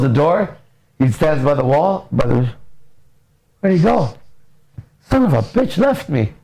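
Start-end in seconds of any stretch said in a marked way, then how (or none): 3.6-6.3 high-pitched mock distress; dismayed dog. Dog left alone behind closed door, mock dismay. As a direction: play an abandoned dog's indignant disbelief.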